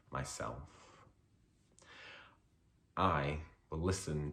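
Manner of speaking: poem reading. Acted. Poet reading his poem on bullying to a festival audience, measured and deliberate. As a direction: let each line land with pauses. Reads poem Not Your GBF.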